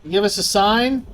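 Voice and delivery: loud, high call to the spirit; talking to a ghost. Real, not acted. Calling out to the ghost.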